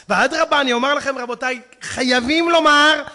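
loud, high, emphatic sermon delivery; full-force preaching. Real, not acted. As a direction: drive the warning into the congregation. (דרשה נלהבת בקול רם)

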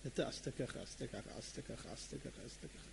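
low murmur, nearly whispered; very soft, low repeated accusations; hushed listing. Acted. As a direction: reel off secrets under the breath. Rabbi privately listing someone's sins.

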